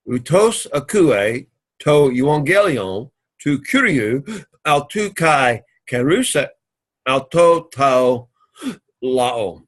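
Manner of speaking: each Greek word read slowly and carefully. Shown as a demonstration. Teacher reading the Greek verse to students, slow and careful.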